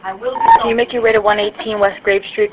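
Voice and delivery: ordinary delivery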